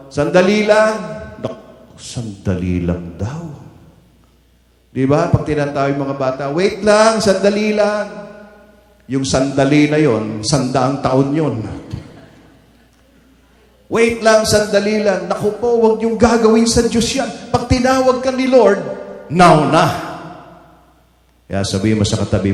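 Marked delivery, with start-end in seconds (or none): 0.1-0.9 put-on high, loud stalling kid; a child putting off a parent; impatient kid. Child answering parent call. 6.5-8.0 high, loud impatient brush-off; mock a kid who won't come; a child stalling when called. 13.9-15.2 high, loud impatient brush-off; mock a kid who won't come; a child stalling when called. 15.2-19.8 urgent exhortation. Real, not acted. Preacher exhorting congregation.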